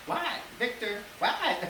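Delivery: high-pitched answer to mom; mock exasperated reply, shooting up in pitch; acted-out back-and-forth with mom; mock yelled exchange. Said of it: "your moms are always yelling at you".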